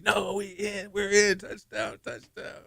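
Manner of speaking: high, hushed mock crowd cheer; imitated crazed crowd. Stadium reacting to the disputed replay, mock excited crowd. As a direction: imitate a far-off stadium roar, fading away softly. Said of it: "the stadium going crazy".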